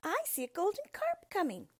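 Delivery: excited small dog spotting the fish; cried out. A narrator's reading. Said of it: "cried out".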